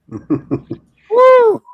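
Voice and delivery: high loud laughing whoop; a real whoop of delight. Real, not acted. Whoops at twist.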